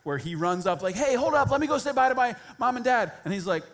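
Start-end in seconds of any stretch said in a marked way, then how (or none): 1.0-3.1 loud, high eager shout to wait; eager student shouting after a leaving mentor; calling out. Retold or imitated. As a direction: yell after him as he walks off. Elisha running after the mentor.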